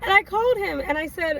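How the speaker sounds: ordinary talk, nothing marked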